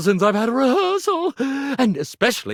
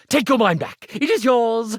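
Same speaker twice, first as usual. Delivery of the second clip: normal talking voice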